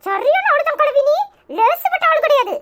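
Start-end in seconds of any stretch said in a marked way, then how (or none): none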